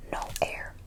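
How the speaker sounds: ordinary talk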